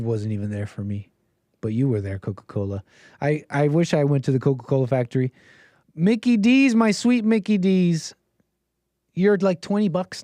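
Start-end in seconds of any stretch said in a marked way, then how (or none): 0.0-2.8 softer, lower, mock-heartfelt ode to a soda; hushed mock tenderness. 5.9-10.2 higher, drawn-out mock swoon over fast food. Mock love for McDonald's.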